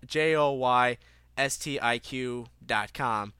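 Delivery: letters J O Y S T I Q spelled out slowly one by one; spelling it out. Shown as a demonstration. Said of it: "That's G O".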